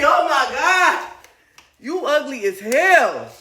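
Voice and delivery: loud slow high yelling; shouting. Real, not acted. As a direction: yell it out, stretched. Shouting insult continues.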